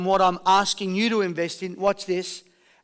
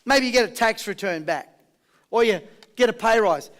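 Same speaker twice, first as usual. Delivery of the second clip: ordinary delivery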